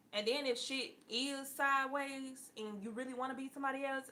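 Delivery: ordinary delivery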